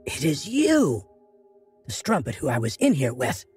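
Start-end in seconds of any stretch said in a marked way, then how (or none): 0.0-1.0 surprised. Acted. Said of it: "Sarah gasped".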